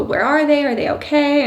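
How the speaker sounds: put-on high, anxious little-girl question; worried child. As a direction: ask like a worried kid. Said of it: "she'd go".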